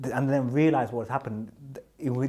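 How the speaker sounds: ordinary delivery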